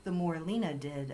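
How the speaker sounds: usual speaking voice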